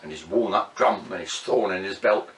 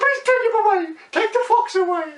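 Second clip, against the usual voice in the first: very high, loud frantic plea; begging chickens; 6 dB louder, faster than usual. Acted. Chickens begging the soldiers, high and frantic. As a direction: squawk for help.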